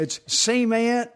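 slow, high mock Southern drawl. Southern joke reply.

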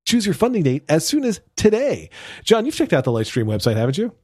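upbeat sponsor read for listeners. Acted. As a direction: read it like a podcast host ad. Host reads sponsor ad.

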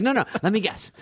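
high-pitched eager waiter; insistent waiter. Acted. Waiter who won't let the diner repeat his order, insistent. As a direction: cut in high, determined to guess.

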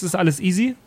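usual speaking voice

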